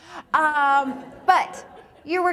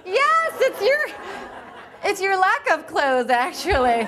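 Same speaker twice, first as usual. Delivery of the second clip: laughing; 8 semitones higher, 5 dB louder than usual. Real, not acted. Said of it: "Is it my clothes?".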